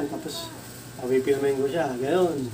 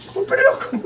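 laughing disbelief; 4 dB louder than usual. Retold or imitated. Incredulous retort with laugh.

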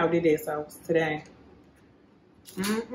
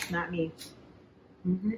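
ordinary talk, nothing marked

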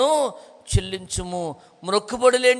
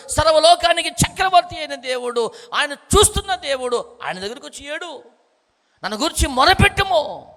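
fervent preaching; 5 semitones higher than usual. Acted. Preacher scolding believers who weep before men, fervent. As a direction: drive it home like a preacher scolding the flock.

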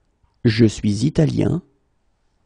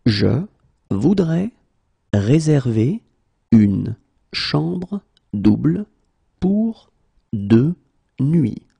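une chambre double stretched slowly; slow, word by word; slower than usual. Shown as a demonstration. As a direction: pause after every word. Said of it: "teacher drills word by word".

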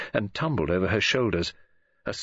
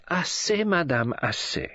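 French; 9 semitones higher, slower than usual. Acted. Poirot cuts off her theories.

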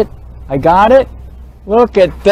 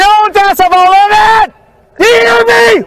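furious shout; 9 semitones higher than usual. Real, not acted. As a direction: yell it at him in a rage. Yelling the point back at the officer.

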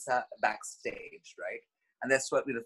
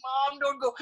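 scared plea; 11 semitones higher, 4 dB louder, slower than usual. Retold or imitated. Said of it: "so I was so scared".

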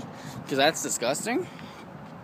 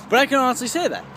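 normal talking voice

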